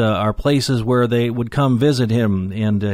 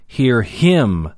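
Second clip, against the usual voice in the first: "him" punched with a strong falling tone; emphatic HIM. Shown as a demonstration. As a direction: lean hard on "him" and let it fall. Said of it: "That's emphatic too".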